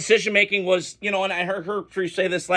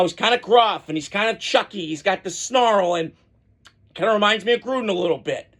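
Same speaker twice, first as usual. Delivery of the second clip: ordinary talk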